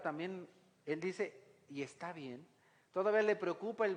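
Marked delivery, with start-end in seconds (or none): 1.7-2.5 worried hush. Retold or imitated. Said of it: "No dice David, "Oye, qué bueno".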